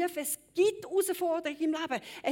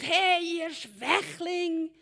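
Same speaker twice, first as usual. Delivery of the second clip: loud, higher taunting giant shouting across the battlefield; Goliath roaring taunts at the Israelites, loud and higher; Goliath bellowing; 4 semitones higher, 6 dB louder than usual. Retold or imitated. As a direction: bellow the insults, dragging the slowest words. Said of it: "übergebrüllt".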